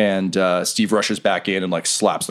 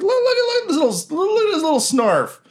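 very high, gushing pet voice; cutesy baby talk; faster than usual. Acted. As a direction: coo quickly over an adorable little creature. Guard gushes over the cute animal.

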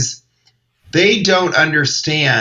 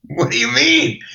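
high, baffled question; can't believe what he just heard; incredulous; 9 semitones higher than usual. Retold or imitated. Comic recalling the bodyguard, baffled.

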